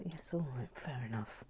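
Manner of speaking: soft unvoiced concession; whispering. Real, not acted. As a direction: whisper it grudgingly. Quiet concession.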